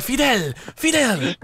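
high, put-on Cuban accent. Player calling for Fidel, mock Cuban accent. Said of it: "Try saying it in a Cuban accent".

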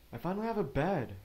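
excited. Real, not acted. Pleased at game progress.

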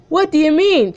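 teacher voice. Acted. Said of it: "I can't do two voices".

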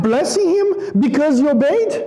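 ordinary talk, nothing marked

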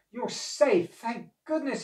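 high, glad exclamation; lifted voice of a delighted old man; relieved cry. A narrator's reading. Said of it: "he cried".

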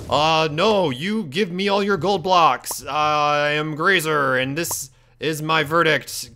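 slow, drawn-out pompous impression; Grazer. Demanding gold blocks as Grazer, hammed-up slow impression. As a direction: proclaim it very slowly, badly faking someone else. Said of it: "I'll be Grazer".

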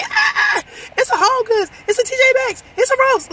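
re-enacted squealing, very high and loud; excited screaming. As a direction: shriek each store name like an overexcited shopper. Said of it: "I'm screaming like".

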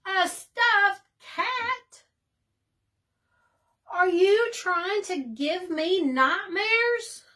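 high and loud, first words stretched slow; mock horror. A narrator's reading. Bird recoiling at Rabbit's stuffed cat, mock horrified. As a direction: recoil as if offered a monster.

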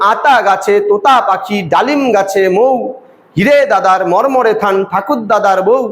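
slow chanted beats on "আতা গাছে", "তোতা পাখি"; lilting rhyme recital, landing on "মৌ" and "বউ"; rhythmic verse reading. Shown as a demonstration. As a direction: recite in measured two-word units, "ডালিম গাছে", then "মৌ". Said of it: "আবার আমরা ওইভাবে করে".

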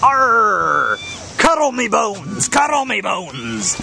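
high, drawn-out mock pirate cry. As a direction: play a pirate, stretching each phrase slowly. Playing a pirate with Arr.

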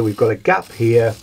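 normal talking voice

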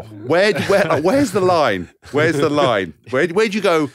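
high, loud disbelief at the cheek; where's the line, said in loud, high outrage; incredulous. Real, not acted. Telling friends about the priest, loud incredulous outcry.

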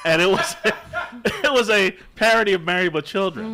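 laughing loudly through the words. Real, not acted. Laughing while telling porn parody.

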